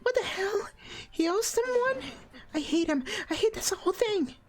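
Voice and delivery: put-on high, accusing young girl, rising to a yell; shrill teen girl accusing everyone; high Hina voice. Hina angry.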